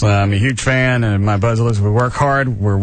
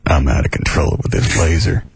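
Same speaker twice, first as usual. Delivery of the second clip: deep, unruffled doctor impression; deadpan the wild claim, low and calm; Doctor Booth; 10 semitones lower than usual. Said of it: "He just talks like this".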